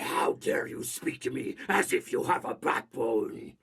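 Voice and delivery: whispered villain threat; menacing whisper. Acted. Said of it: "My inspiration for, for this was Rasputin".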